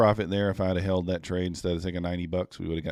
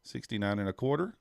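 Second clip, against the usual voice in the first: usual speaking voice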